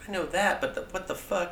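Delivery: confused fan. Retold or imitated. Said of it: "they're just like".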